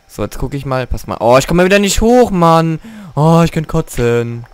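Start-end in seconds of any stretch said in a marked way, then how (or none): 1.2-4.4 loud, higher, exasperated; frustrated outburst. Real, not acted. Gamer stuck in the aquarium, loud exasperated groan. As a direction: vent being stuck again.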